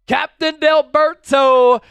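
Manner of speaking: high, loud, drawn-out name announcement; stretched-out, booming fanfare; hyped shout-out. Real, not acted. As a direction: announce the donor like a ring announcer, slowly. Announces super chat donor with fanfare.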